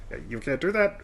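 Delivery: lightly squeaked cartoon voice; Mickey Mouse. Shown as a demonstration. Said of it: "if Mickey Mouse is going".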